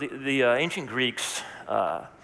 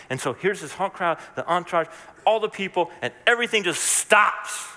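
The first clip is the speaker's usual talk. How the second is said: rising higher and louder; building excitement; 8 semitones higher, 4 dB louder than usual. Real, not acted. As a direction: build it up to the stop. Building to dramatic stop.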